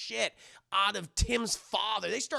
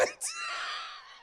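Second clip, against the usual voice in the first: very high squealing laugh; 6 dB quieter than usual. Real, not acted. Cracks up after the shout.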